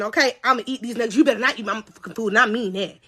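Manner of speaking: normal talking voice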